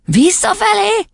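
very high, slow baffled question; taken aback. A narrator's reading. Boy asked to recite backwards, taken aback. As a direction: ask it in stunned disbelief. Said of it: "hökkent meg Vilmos".

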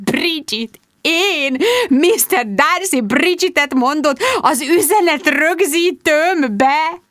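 slow, high, swooning amazement; overwhelmed thrill. A narrator's reading. Bridget hears her idol say her name, swooning. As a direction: marvel at it, stretching the words. Narrator ecstatic over the message.

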